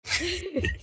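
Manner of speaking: bright burst of giggling; high giggle. Real, not acted. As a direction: giggle at the joke. Laughing at the Eeyore voice.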